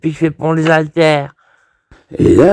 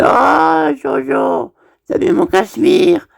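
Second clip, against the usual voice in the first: lifted pitch, fussy indignant friend; mock-precious voice of a friend; high fussy friend; 9 semitones higher than usual. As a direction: play Giulio high and fussy about his sweater. Giulio voiced fussing over cashmere.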